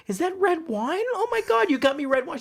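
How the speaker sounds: high-pitched, thrilled teacher; hammed-up delighted teacher; excited teacher. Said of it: "teacher says".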